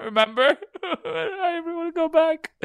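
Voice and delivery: hammed-up crying laugh; laughing mock sob. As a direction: fake weep through laughter. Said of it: "mocking sentimental plea".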